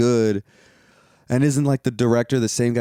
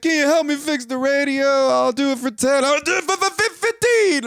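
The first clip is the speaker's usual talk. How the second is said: much higher idiotic mock voice, stretched at the end; mock the man as an idiot; mocking his voice; 12 semitones higher than usual. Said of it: "whatever the fuck he talks like".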